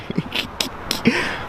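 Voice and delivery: soft, high breathy giggle; short high laughing fit. Real, not acted. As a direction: snicker quietly on the breath. Laughing at the dog.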